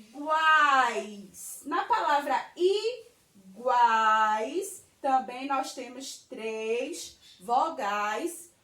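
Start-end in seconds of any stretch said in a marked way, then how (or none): 0.0-1.6 Iguais split as I, then a long loud GUAIS; syllable by syllable. Shown as a demonstration. Teacher sounding out example word. 3.3-4.8 iguais in two slow beats, i... guais; syllable by syllable. Shown as a demonstration. Teacher sounding out example word.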